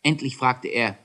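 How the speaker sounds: ordinary delivery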